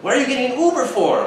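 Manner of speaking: high-pitched mock challenge; nagging questioner. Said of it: "And he goes".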